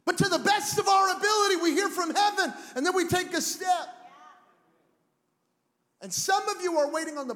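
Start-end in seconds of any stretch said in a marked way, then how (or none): 0.1-3.9 loud, high preaching call; preaching shout. Acted. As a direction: preach it out full force. Preaching at full force.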